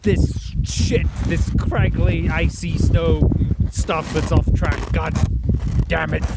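stuck in crusty snow, fed up; frustrated. Real, not acted. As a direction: vent at the icy snow, dragging it out.

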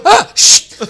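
sudden high, loud splutter as drink sprays; mock splutter. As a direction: burst out with a shocked spluttering cry. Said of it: "So he's just gone".

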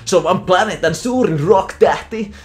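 put-on high, slow, loud squeaky teen; cartoon son gushes about his idol. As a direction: squeak it out like a starstruck cartoon kid. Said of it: "Max on silleen".

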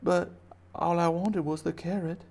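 high little-bunny voice; plaintive baby bunny. Acted. Bunny explaining it only wanted carrot, high plaint. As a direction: plead it small and high. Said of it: "And he's like".

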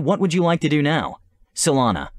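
ordinary talk

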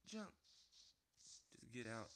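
ordinary talk

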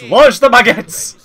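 rally the troops over the bakery; mock war cry.

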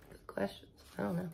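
whispered, bemused answer; dad's hushed reply. Acted. Dad answers Penny's questions, soft whisper.

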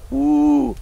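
one long, high whoop; excited woo. Real, not acted. Preacher glad his parents made him go, whooping. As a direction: let out a high whoop of joy. Exclaims about summer Bible school.

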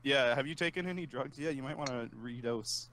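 ordinary talk

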